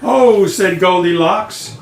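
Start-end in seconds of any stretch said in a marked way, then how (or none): 0.0-0.4 higher startled exclamation; a sudden high cry on waking; surprised. A narrator's reading. Goldilocks wakes to see three bears, surprised. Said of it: "She was so surprised".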